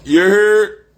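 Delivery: high, loud holler; emphatic call-out. Real, not acted. Emphatic catchphrase called out.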